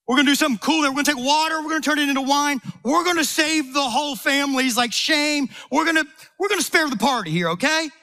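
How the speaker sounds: Jesus bubbling over with plans for Peter; excited Jesus. Retold or imitated. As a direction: pitch it up like an excited friend sharing big plans.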